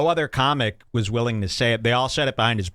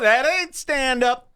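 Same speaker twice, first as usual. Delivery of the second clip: mocking impression; 12 semitones higher, 3 dB louder, slower than usual.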